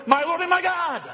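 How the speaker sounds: high, fervent cry of faith; acted exclamation. Thomas recognising the risen Lord, fervent cry. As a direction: proclaim it with pitch soaring. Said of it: "Thomas yells out".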